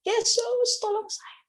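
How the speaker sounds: high pleading call; kneeling in the street, calling Jesus in a high plea. Retold or imitated. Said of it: "Saya panggil".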